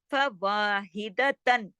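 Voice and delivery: slow फवाहिदतन with long waa; short fa, stretched waa, measured ending in फवाहिदतन; two-count waa. Shown as a demonstration. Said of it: "वा दो हरकत". (फवाहिदतन के वा को दो हरकत खींचकर)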